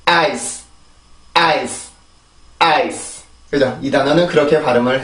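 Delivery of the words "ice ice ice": 아이스 as English "ice", stressed vowel, short soft S; soft final S. Shown as a demonstration. Teacher modelling for repetition, 아이스 with soft final S. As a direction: end 아이스 on a light S with no added 으 vowel. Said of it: "순수하게 이 자음만 만들어주고".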